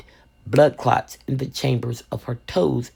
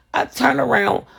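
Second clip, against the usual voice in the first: ordinary talk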